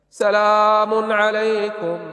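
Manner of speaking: very slow, drawn-out chanted سلام عليكم, voice faltering; choked greeting. Acted. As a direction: stretch سلام عليكم slowly, then break off. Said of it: "وسكت وأخذ يبكي". (سلام ممدود متهدج قبل البكاء)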